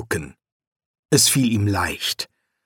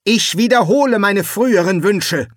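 firm voice; 8 semitones higher, 3 dB louder than usual. A narrator's reading. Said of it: "sagte er mit fester Stimme".

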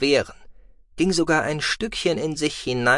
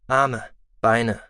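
plain reading voice

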